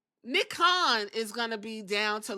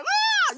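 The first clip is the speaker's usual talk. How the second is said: high loud hammed-up "Ah!"; mock scream; 6 dB louder than usual. Imitating Brock Lesnar's scream, high and loud. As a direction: let out a wrestler's mock scream. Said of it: "Brock Lesnar was like".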